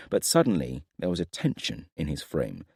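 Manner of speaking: plain reading voice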